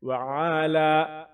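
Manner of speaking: وعلا with the final a held one alif long. Shown as a demonstration. Teacher shows the stretched vowel in وعلا. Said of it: "ایک الف کے برابر کھینچیں".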